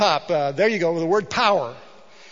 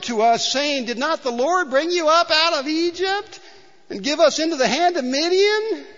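whiny Gideon; 8 semitones higher than usual. Acted. Preacher voicing Gideon's whiny protest to the angel. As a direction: moan it out like a self-pitying coward. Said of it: "whiny, sickening kind of voice".

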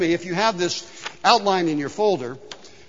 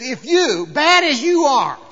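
loud, raised, drawn-out scripture quote; emphatic preaching; 6 semitones higher, 6 dB louder, slower than usual. Acted. Preacher quotes Jesus to the congregation, emphatic. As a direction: hit each phrase hard and high.

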